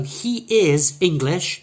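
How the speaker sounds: slow, high, loud, long ee in "he", short I in "is English"; long E then short I. Shown as a demonstration. Teacher demonstrating the vowels in a sentence, long then short. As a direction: stretch "he" in "he is English". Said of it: "has a long".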